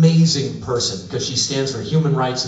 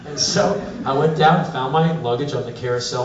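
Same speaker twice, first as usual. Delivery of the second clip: ordinary talk, nothing marked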